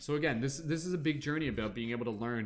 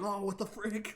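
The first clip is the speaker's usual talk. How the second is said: much higher, squeaky annoyed cry; exasperated squeal; 8 semitones higher than usual. Acted. You reacting to new challenges, higher squeaky exasperation. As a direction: whine it like a fed-up kid. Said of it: "you're just like".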